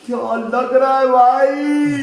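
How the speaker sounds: slow, loud, drawn-out cry of delight; excited shout. Real, not acted. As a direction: belt it out in awe. Admiring the silver play button.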